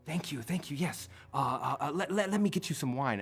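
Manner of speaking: high, fast, fawning; subservient servant. Acted. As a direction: scramble to please. Nightbloom subservient.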